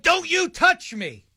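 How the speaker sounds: slow, high snippy retort; mock angry snap. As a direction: imitate her snapping at the baby. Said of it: "whipped around and said".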